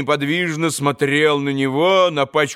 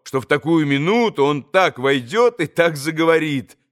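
plain reading voice

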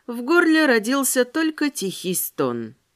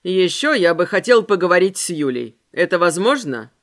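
lower man's voice; 5 semitones lower, 3 dB louder than usual. Acted. Ramirez voiced.

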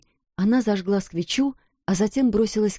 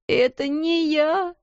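sobbing; 9 semitones higher, slower than usual. A narrator's reading. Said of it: "всхлипывая".

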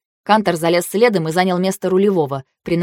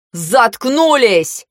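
slow, drawn-out raised command; exasperated order; 4 semitones higher, much slower than usual. A narrator's reading. Driver silencing quarrelling passengers, exasperated roar. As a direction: stretch it out to shut them up. Voiced order to squabbling girls.

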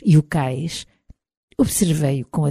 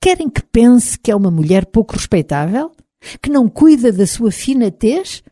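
scandalized questions, pitched higher; 6 semitones higher, 4 dB louder than usual. A narrator's reading. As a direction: ask as if mildly shocked, voice raised in pitch. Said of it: "olhou-me escandalizado".

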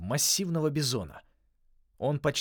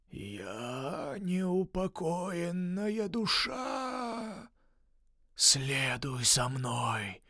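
slow, eerie high ghost voice; drawn-out spooky ghost whisper; ghostly whisper; 9 semitones higher, much slower than usual. Acted. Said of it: "я прошептал загробным голосом".

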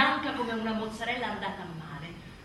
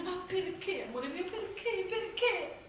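despairing plea; 6 semitones higher, 5 dB quieter, slower than usual. Acted. Pleading with crying baby.

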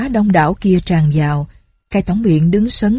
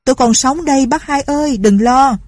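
calling out; 7 semitones higher than usual. A narrator's reading. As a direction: call out to reassure him. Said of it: "tri hô lên".

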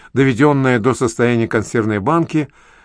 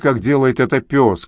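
ordinary delivery, nothing marked

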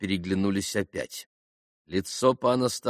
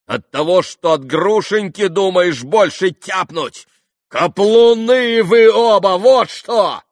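high shouted insult, building; desperate; 11 semitones higher, 11 dB louder, slower than usual. A narrator's reading. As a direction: shout it as if all is lost. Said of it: "как отчаянный, поняв, что всё кончилось". (отчаянный, яростный крик)

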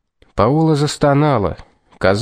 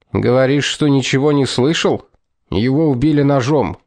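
ordinary delivery

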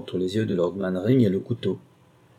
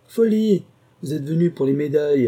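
a sudden high, loud cry of scorn; scornful outburst; 6 semitones higher, 4 dB louder than usual. Acted. The woman scorning the hesitant thief, loud scornful outburst. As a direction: fling the word at him.

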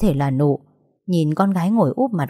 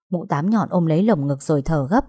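ordinary delivery, nothing marked

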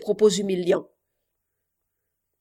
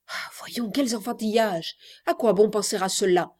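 ordinary talk, nothing marked

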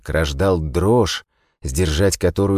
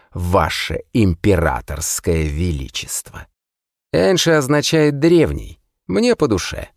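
mocking; 3 semitones higher than usual. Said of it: "издевательски добавил". (издевательски, с насмешкой)

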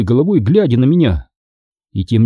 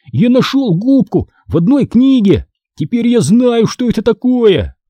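high-pitched, eager announcement; excited exclamation; 11 semitones higher than usual. A narrator's reading. Brother telling his sister he found the sponge, exclaiming excitedly. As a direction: burst out with the news, pitched high. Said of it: "воскликнул он".

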